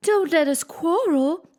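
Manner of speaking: high, slow fretful plea; calm the quarrel nervously; anxious tone. Acted. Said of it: "said in an anxious tone".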